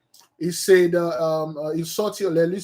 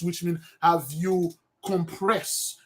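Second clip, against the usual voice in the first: ordinary talk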